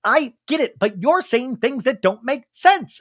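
high, slow imitated yell; mock yelling. Anna Marie argues with Sutton, mock yelling. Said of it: "And Anna Marie's yelling".

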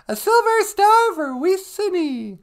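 very high, loud, slow announcement; high comic puppet voice; squeaky sidekick. Pretend. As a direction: squeal it out ceremonially. High sidekick awards a star.

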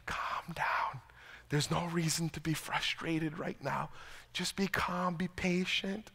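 soft, whispered, soothing; wife's soothing hush. Retold or imitated. Wife calming frustrated husband, soft whisper. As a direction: settle him down in a hush. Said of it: "She's like".

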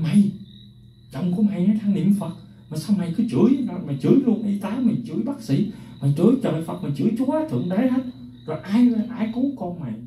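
high-pitched scolding tirade, pitch way up; angry scolding. Retold or imitated. A friend scolding the grieving wife, high angry scolding. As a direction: scold her hard for cursing everyone. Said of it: "người bạn mới chồng cô ta nói".

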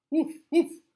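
loud high woof like a dog; dog bark. Acted. Dog barking in story.

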